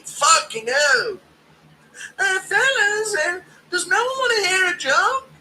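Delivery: high, loud, whiny mock Gary; Ian Gary impression. Streamer mocking Gary irritating Palestinians, high whine. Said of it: "using Ian Gary as a tool of war".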